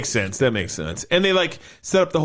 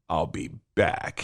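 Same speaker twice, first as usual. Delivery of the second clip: much lower, slow, softer movie-star catchphrase; Arnold impression; 11 semitones lower, 7 dB quieter, slower than usual. Podcast host quotes John's line in a slow, low Terminator voice. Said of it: "John's like".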